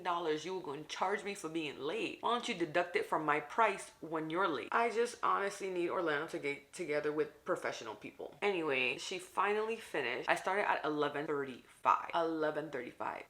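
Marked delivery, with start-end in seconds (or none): none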